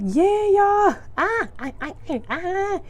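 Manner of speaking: very high, over-the-top happy squeal; hammed-up excitement masking sadness; fake cheer. Depressed, faking it for everyone, fake cheer. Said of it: "I'm a good faker. I be faking real bad".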